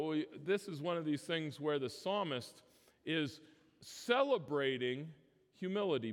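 ordinary delivery

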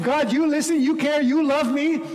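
high, earnest appeal to God; acted prayerful cry. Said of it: "it's crying out to God".

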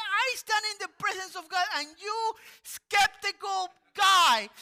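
high, loud, shouting rebuke; hammed-up angry Gabriel; angel shouting. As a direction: bellow it at the doubter. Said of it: "It's like".

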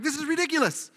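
fed-up retold outburst; exasperated. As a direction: throw up your hands at the absurdity. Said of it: "and I'm like".

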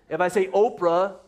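ordinary delivery

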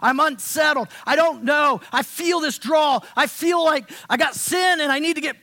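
blurting out a restless stirring inside; unsettled cry. Retold or imitated. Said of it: "It's like".

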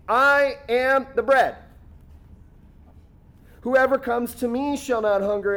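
exasperated. Acted. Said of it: "it's almost exasperated here".

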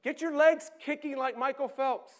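loud, high, bossy calling from the bank; shouting instructions. Acted. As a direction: bark orders at the swimmer. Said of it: "shouting instructions to you".

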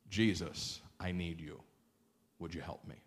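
very soft, low plea; humble plea. Acted. Soldier humbly asks Jesus for a favor, hushed plea. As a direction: plead quietly, trailing almost to nothing. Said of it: "And he humbles himself".

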